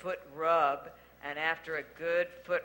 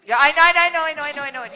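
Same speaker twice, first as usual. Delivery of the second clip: loud hushing; 6 semitones higher, 11 dB louder, much faster than usual. Real, not acted. Hushing a guest off-mic.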